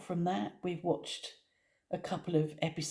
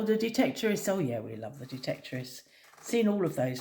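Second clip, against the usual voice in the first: ordinary talk, nothing marked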